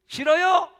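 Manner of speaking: put-on girl refusing the police, loud; defiant refusal.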